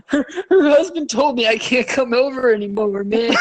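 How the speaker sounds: high mock whine breaking into laughter; mock distraught. Whining that her husband banned him, mock distraught. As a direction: lament it in a hammed-up high voice. Acted breakup meltdown continues.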